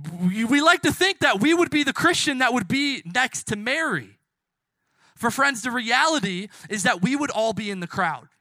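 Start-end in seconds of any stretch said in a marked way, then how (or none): none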